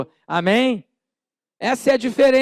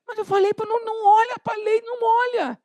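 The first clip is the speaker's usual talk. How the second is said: high, whining protest with a crying edge; whiny angry complaint; 12 semitones higher than usual. Retold or imitated. The pie victim protests to the crowd, high whiny whine. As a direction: complain like the tricked man, near tears. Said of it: "o cara queria brigar".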